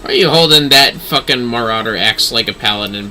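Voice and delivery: ordinary talk, nothing marked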